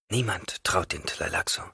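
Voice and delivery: quiet bitter whisper. Acted. Hwi's bitter remark.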